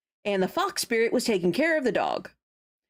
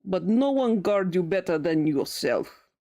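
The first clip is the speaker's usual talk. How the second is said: put-on deliberately hammy Russian accent; amateur Russian-accented character voice; bad Russian accent; 3 semitones lower than usual. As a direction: read it in a clumsy fake Russian accent. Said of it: "my very bad Russian accent".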